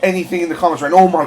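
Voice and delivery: loud excited pleading; overexcited babbling. Real, not acted. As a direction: beg them loudly, thrilled. Watching trailer, loud.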